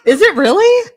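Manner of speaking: high, loud amazed laugh; can't believe it, laughing; surprised. Real, not acted. Shocked that it tastes good.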